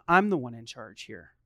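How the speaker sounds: low, controlled parental firmness; calm authority. Acted. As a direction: state it low and evenly. Dad asserting authority, first take.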